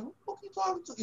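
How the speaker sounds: rookie's silent comeback to a scolding veteran; defiant inner retort. Retold or imitated. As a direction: fire back fast in your head. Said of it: "but I was like".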